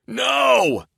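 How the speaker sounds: very high, loud held roar; loud high anguished roar. A narrator's reading. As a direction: roar it while lunging at the door. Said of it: "I roared".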